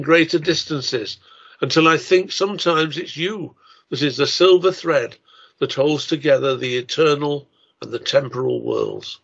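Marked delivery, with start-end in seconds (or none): none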